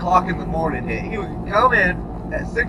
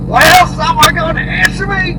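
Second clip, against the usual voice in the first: higher, louder demand; demanding boss; 9 semitones higher, 9 dB louder than usual. Retold or imitated. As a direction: insist someone confess now. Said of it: "And he'd be like".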